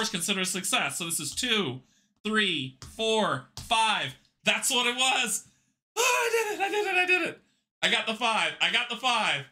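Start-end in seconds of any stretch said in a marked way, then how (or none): 6.0-7.4 high, laughing cry of victory; laughing triumph. Real, not acted. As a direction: laugh out the win in delight. Winning the game.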